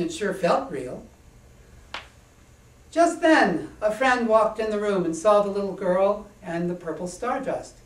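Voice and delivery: plain reading voice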